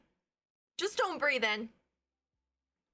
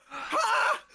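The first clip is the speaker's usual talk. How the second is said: gasping shriek laugh; 12 semitones higher than usual. Real, not acted. Gasp then shrieking laugh.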